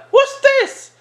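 put-on very high falsetto kid voice; hammed-up high-pitched indignation; mock teenager. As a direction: squeak it like an affronted child. Voices the disappointed teenager's question.

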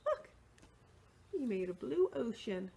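plain reading voice

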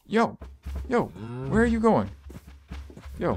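high, startled call after a runaway cow; high yelp. Real, not acted. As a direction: pitch it way up as the cow bolts. Cow running off.

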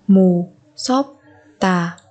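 "Mù sốp tà" in separate syllables. Shown as a demonstration. Teacher modelling a word for learners, syllable by syllable. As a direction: break "Mù, sốp, tà" apart.

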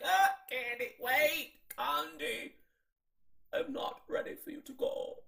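high put-on RuPaul voice, fading to a whisper; imitating RuPaul. RuPaul asking Kandi not to leave, high put-on voice. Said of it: "She didn't say it quite like that".

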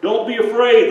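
called loud and high across the hills; shepherd calling loudly to the lamb. Acted. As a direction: shout reassurance to a lost lamb. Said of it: "the shepherd called out".

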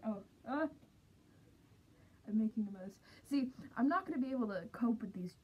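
muffled inside mask; through a costume head. Real, not acted. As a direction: voice behind covered face. Seen 1: full costume head covers face throughout; refs show bare face.

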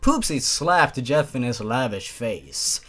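ordinary talk

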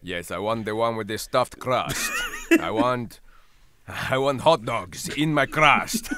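loud, slow hammed-up Italian accent. Italian character phoning a bad pizza place, Italian accent. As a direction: order grandly in a thick mock-Italian accent. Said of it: "what kind of a full-blooded Italian would call".

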